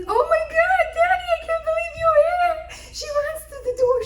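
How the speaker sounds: very high, loud, fast excited squeal; ecstatic squeal. Retold or imitated. Daughter greeting her father at the door, very high squeal. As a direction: shriek with delight at the door.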